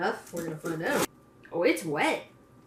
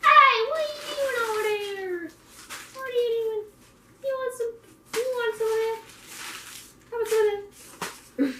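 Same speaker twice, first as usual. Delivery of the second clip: high pet voice; 5 dB louder than usual. Real, not acted. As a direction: squeal up high at the animal. Talking to a pet.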